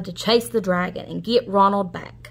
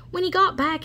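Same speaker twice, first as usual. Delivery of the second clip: usual speaking voice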